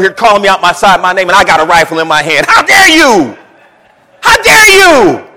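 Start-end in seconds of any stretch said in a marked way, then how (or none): 2.5-5.3 high, loud indignant cry; rising outrage, slow and loud on the second. Retold or imitated. Recruit insulted by the drill sergeant, loud indignant outrage.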